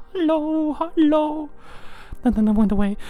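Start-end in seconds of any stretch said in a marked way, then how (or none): 0.1-1.5 lilting higher greeting while waving; higher sing-song hello. Retold or imitated. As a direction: call hello high and bright. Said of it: "waving in the background".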